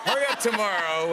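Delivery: booming trailer announcer; movie trailer voice. Pretend. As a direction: sell it like a blockbuster trailer. Host mock movie trailer intro.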